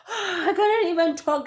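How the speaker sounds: high, gasping re-enactment; acted breathlessness after a sprint; out of breath. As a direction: gasp in before forcing the words out. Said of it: "I was like, out of breath".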